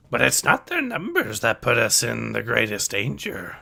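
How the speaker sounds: put-on old master's voice with pitch leaps; Yoda's voice. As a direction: caution them as the wise sage.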